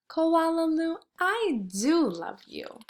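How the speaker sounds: high, tender mother's voice; loving mother. Acted. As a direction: coo it to your little one. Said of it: "her mother would smile and say".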